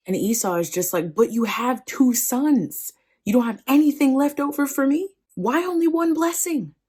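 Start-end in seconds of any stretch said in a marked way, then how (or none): 1.1-6.7 high, escalating aggrieved plea; hammed-up tearful protest; mock sobbing. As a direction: wail at your father, voice climbing. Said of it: "Okay, he's sobbing now".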